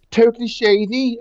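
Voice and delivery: sending up a bad Scouse impression; bad Liverpudlian accent. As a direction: ham up a terrible Liverpool accent. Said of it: "the worst Liverpudlian accent ever".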